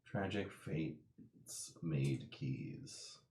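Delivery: very soft, slow and low; hushed, drawn-out pondering; musing to oneself. Real, not acted. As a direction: murmur the riddle while puzzling over it. Rereading the riddle to oneself, pondering.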